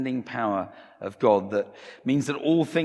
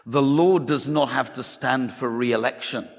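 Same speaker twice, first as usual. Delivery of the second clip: louder, higher Scottish pastor, laying down the law; Scottish accent; 4 semitones higher, 5 dB louder than usual. Acted. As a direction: put on a Scottish preacher's accent. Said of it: "Sorry for my Scottish accent".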